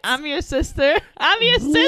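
retelling a Joe Dirt scene, hammed-up shouting reveal; mock shout of I'm your sister. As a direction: yell it like a movie heroine blurting a secret. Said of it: "and she's like".

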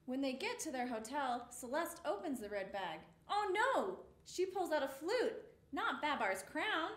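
plain reading voice

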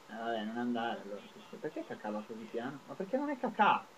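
normal talking voice

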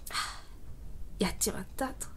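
very soft, sinking "I blew it"; hushed, crestfallen self-reproach; defeated sigh. Retold or imitated. Acts out sense of defeat.

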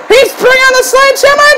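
high, fast excited call; alarmed shout. Real, not acted. Commentator spotting a sledgehammer, alarmed excited shout. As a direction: burst out as the weapon appears. Weapon brought into ring.

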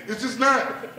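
ordinary talk, nothing marked

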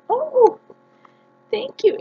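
high, gushing thank you; delighted thanks. Real, not acted. Vlogger thanking the shop owner, high and gushing. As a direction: squeal your thanks. Finding gifts in the box.

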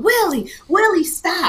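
high, loud panicked scream of a name; screaming for him to stop. Retold or imitated. Girl in the car screaming at the driver to stop. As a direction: scream his name in terror. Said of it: "and I was screaming".

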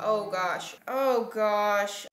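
ordinary delivery

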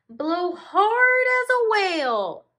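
very high, loud cheering voice; encouraging snail. Acted. Snail encouraging a big blow, high cheering voice. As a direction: cheer the kid on. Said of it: "encouraged the snail".

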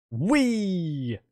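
high joyful squeal while flying; gleeful whee. Real, not acted. Player squealing as the magic carpet swoops, gleeful. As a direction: let out a delighted whee as the carpet takes off.

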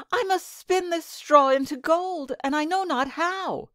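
put-on high, anxious young girl; distressed maiden. A narrator's reading. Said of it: "said she".

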